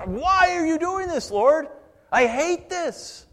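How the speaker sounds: very high, loud wail at God; a suffering believer wailing at God, high and slow; anguished cry. Acted. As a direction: break into a pained slow cry on I hate this. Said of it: "she cried out to God".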